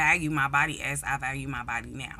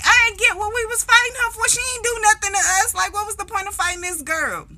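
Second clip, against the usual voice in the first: exasperated protest; 6 dB louder, faster than usual. Retold or imitated. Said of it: "I'm like".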